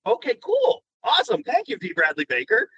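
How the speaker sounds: high, bright thanks; gleefully grateful; delighted. Retold or imitated. Thanking D Bradley Baker, high delighted voice.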